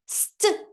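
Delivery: "St" as s and t sounds joined, not letter names; only the opening sounds "St" of stop; isolated blend. Shown as a demonstration. Teacher showing first consonants together, bare "St" blend. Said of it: "o S e o T junto".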